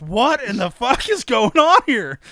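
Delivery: high, loud stunned question; mock astonishment. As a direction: voice the gaping onlookers' disbelief. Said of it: "got their mouths open".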